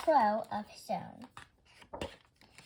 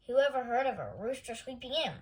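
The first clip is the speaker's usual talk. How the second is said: ordinary delivery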